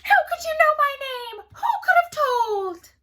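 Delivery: very high, loud shrieking imp; furious scream. A narrator's reading. Rumpelstiltskin shrieks at queen, very high and loud. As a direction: scream it in outrage. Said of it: "Rumpelstiltskin screamed".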